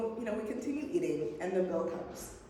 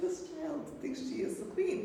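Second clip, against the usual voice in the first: tag; 10 semitones higher than usual. Retold or imitated. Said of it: "I'm just like".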